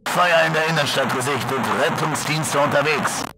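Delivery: soft crackling news voice; fading shortwave radio. Acted. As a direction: read like a distant broadcast.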